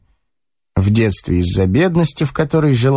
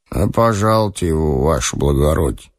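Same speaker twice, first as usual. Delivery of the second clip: lazy, drowsy servant, sinking low; sleepy orderly; 7 semitones lower than usual. A narrator's reading. Sleepy orderly lets an officer in, lazily. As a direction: mumble it as if half asleep. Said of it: "ленивый, заспанный денщик".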